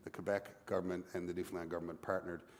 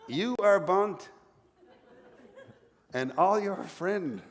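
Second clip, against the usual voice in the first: halting mock French accent, higher and drawn out; bad French accent; 7 semitones higher, 5 dB louder, slower than usual. French Quebecer at bar telling off Newfoundlanders, bad French accent. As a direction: attempt a French accent, word by word with big pauses. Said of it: "bad attempt at a French accent".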